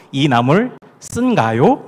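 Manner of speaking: slow, proper standard Seoul; educated Seoul speech. Shown as a demonstration. Pastor modelling proper modern Seoul Korean, slow standard speech. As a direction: say it slowly and correctly, cultured Seoul. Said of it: "정확하게 교양 있는 현대 서울말로".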